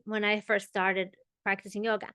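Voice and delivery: ordinary delivery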